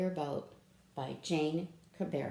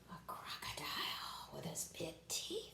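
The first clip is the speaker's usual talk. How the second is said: whisper; 3 semitones lower, 9 dB quieter than usual. Acted. Scary crocodile page.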